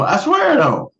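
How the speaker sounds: squeaky high "I swear"; high playful denial. Real, not acted. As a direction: protest innocence in a high voice.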